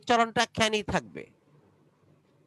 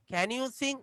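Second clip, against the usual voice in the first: "Can you sing?" pitched higher, rising at "sing"; rising question; 4 semitones higher, 6 dB quieter, much slower than usual. Shown as a demonstration. Teacher asking Nasu a question: high rising tune. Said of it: "উঁচার দিকে উঠছে কথাটা".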